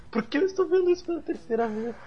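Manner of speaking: exasperated Chandler. Retold or imitated. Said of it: "E o Chandler".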